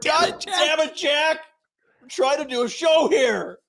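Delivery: high, half-hearted boss yell; hammed-up fed-up boss; mock exasperated yell. Mock boss yelling at Jack to leave, half-hearted. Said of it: "Jack's our Toby".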